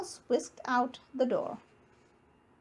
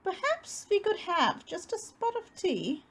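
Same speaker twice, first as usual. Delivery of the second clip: higher, polite little mouse; lightly voiced mouse asking sweetly; 7 semitones higher than usual. A narrator's reading. Mouse politely asking bear for tea, higher voice. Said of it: "said the mouse".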